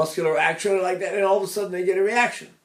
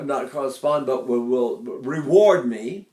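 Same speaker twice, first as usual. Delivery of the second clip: ordinary delivery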